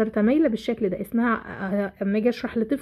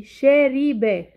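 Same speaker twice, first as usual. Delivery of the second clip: "شاربة" stretched very slowly, louder, syllable by syllable; sounded out slowly; 3 semitones higher, 5 dB louder, much slower than usual. Shown as a demonstration. Teacher presents the next word to analyse, "شاربة" sounded out slowly. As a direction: draw out each syllable of "شاربة". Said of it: "بحلل صوتي".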